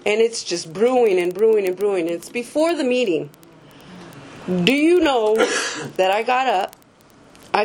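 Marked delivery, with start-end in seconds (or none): none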